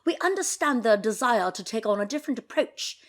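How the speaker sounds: mock posh British accent; jokey British accent for the palace statement. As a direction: read the statement like a stiff British royal aide. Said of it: "I should do this in a British accent".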